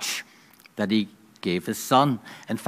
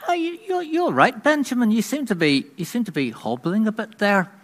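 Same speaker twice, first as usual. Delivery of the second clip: much higher, fussing wife voice; high-voiced concerned wife; wife Rebecca; 11 semitones higher than usual. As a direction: play the worried wife, pitched way up. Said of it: "And Rebecca says to".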